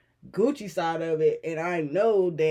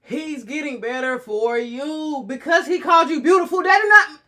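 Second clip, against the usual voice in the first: irritated; 9 semitones higher, 8 dB louder than usual. Real, not acted.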